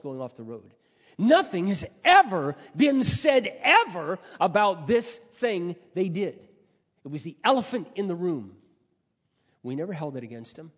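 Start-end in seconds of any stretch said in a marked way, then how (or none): 1.2-8.6 slow, loud and higher, hammering each phrase; impassioned preaching. Real, not acted. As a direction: preach it at full force, slowly. Preacher's emphatic climax.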